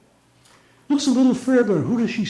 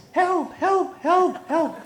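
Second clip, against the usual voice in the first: high squawked help; parrot crying help; 12 semitones higher, much slower than usual. Acted. Female parrot calling for help, high cry. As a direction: cry help like a scared bird.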